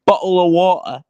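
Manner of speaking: mock Cockney. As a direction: ham up a Cockney accent. Mocking British accents.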